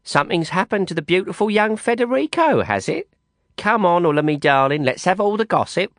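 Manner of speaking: a cheeky friend wheedling the news out of her; lightly voiced teasing Londoner. A narrator's reading. Letty teasing for gossip.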